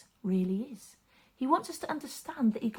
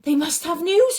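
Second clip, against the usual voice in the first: a touch of eager hope, higher and louder; father's hopeful thought; 7 semitones higher, 12 dB louder than usual. Acted. Father hurrying home wonders what the servants bring, eager. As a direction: wonder aloud, hoping. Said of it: "he thought".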